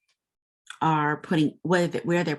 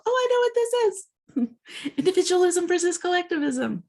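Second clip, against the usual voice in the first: high, fast, gleeful aha with a laugh; excited realization; 14 semitones higher, faster than usual. Retold or imitated. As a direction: burst out with delight at spotting it. Viewer recognizes the pattern.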